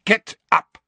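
sharp, hissed command. Acted. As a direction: snap it out. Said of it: "said Snape sharply".

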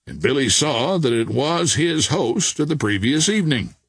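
plain reading voice

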